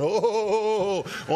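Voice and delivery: slow, high, laughing mock cries of alarm; comic high wobbling oh sounds; mock flailing. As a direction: ham up a clumsy wave-walker. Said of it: "I don't believe Jesus is on the waves going".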